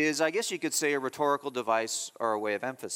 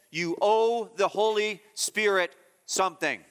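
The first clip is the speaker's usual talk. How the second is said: rising, insistent preaching, pitched higher; forceful preaching; 5 semitones higher than usual. Acted. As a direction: drive the point home from the pulpit. Preacher builds to forceful climax.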